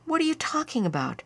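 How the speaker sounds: high, afraid and impatient; scared mom losing patience; fearful impatience. A narrator's reading. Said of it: "fear was mixed with impatience".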